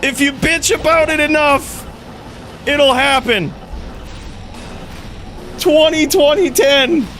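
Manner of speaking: high, excited, still shouting; giddy relief. Real, not acted. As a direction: ecstatic streamer yelling. Celebrating the rare drop.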